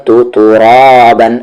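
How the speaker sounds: ordinary delivery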